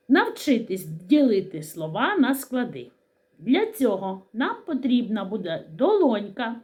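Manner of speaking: ordinary talk, nothing marked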